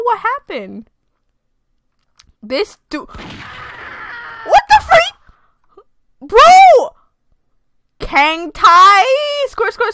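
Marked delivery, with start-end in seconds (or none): 3.0-5.1 drawn-out, very high, slow cry; stunned shout. Real, not acted. Shocked by game event. 6.2-6.9 high, loud exclamation; shocked shout. Real, not acted. Reacting to shock.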